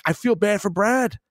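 high, mock-pitying line; own reaction quote. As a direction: pitch it up like a sorry fan. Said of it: "I'm like".

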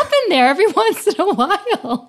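high, giggly laughing voice. Real, not acted. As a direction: laugh through the words. Laughing at her own accent.

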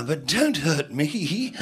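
slow, whimpering mock plea; comic pitiful begging; mock pleading. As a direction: beg like a coward, dragging the words out. Acts out pleading not to be hurt.

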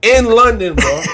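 ordinary talk, nothing marked

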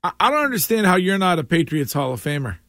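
ordinary delivery

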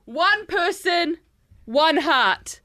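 slow, separated phrases with dramatic pauses; slam poetry. Acted. Host apologising to Crocs in verse, slam poetry. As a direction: declaim each fragment like profound verse. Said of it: "so it's in that style".

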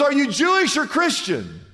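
high-pitched acted question; hammed-up eager passerby. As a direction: press on excitedly for common ground.

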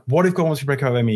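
hurried, run-together repetition; racing through the same question; rapid repeating. Retold or imitated. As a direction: rattle it off under pressure. Forced to repeat it in panic.

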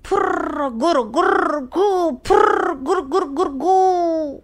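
high, slow snorting grunts; playful piggy snorts; piggy grunting call. Acted. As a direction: snort it out like a happy little pig. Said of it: "गुरफुरत मला हाक मारली".

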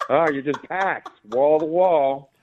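high, laughing sarcasm; sneering sarcastic aside, half laughing; sarcastic mock. Mocking hostess about empty restaurant.

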